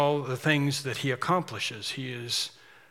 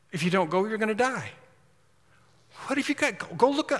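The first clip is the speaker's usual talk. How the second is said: much higher, urgent pleading; pressing friend; 5 semitones higher than usual. Retold or imitated. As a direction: push him to go before it is too late. Urging a bitten friend to look.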